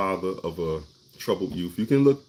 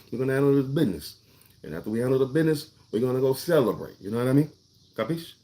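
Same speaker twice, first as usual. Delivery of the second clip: higher, quick Italian wiseguy patter with street lingo; Italian-American tough guy; 5 semitones higher, faster than usual. Acted. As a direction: streetwise Italian mobster accent, talking business. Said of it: "like, Italian accent with our lingo".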